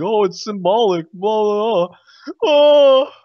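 high, loud mock gushing; hammed-up crowd voice rising into a wail; mocking imitation. Mimicking everybody reacting to the game's ending, loud high mockery.

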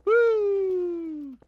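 high excited whoop. Real, not acted. Wrestling celebration cry.

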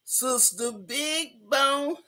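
stretched slow and soft; slow drawl. Acted. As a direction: draw the name out slowly. Host drawls club name.

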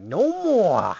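hammed-up angry brother yelling; mock outburst. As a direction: bellow it like laying down the law. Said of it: "He's like".

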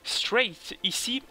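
slow, exaggerated English accent on the game setting; mock English accent. Reading an English game label to viewers, in a mock English accent. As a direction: drawl it out like a hammed-up English speaker. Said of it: "ce magnifique accent anglais".

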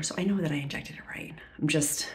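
breathy, voiceless whisper to herself. Real, not acted. Nervous vlogger reassuring herself after the shot, whispering.

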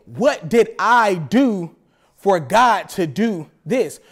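low, slow mocking complaint; slow whiny self-pity. Preacher mocking a believer's self-pity, slow and low. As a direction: drag it out like a sorry believer. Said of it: "listen to what you said".

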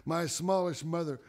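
ordinary delivery, nothing marked

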